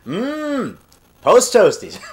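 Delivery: long savoring hum, then the product name; lightly imitated cereal commercial pitch; Andy Griffith. Imitating an old cereal commercial, savoring. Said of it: "where I imitate Andy Griffith".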